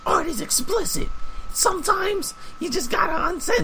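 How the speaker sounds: high, exaggerated mocking voice. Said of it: "I was like".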